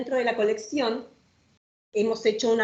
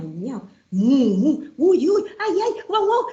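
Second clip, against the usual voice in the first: louder, climbing chorus of meows, moos and barks; animal noises; 6 semitones higher, 5 dB louder, slower than usual. Acted. Reading the rhyme, the barnyard chorus builds up.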